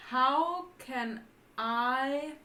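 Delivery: very slow, drawn-out words; word-by-word reading. Real, not acted. Translating for co-player word by word, very slow. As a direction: stretch out each word as you work it out.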